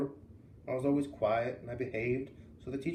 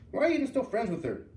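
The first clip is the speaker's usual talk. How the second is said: grandma's outraged question, pitched higher and louder; indignant grandma; 10 semitones higher, 5 dB louder than usual. Retold or imitated. Grandma scolding her granddaughter, indignant and high.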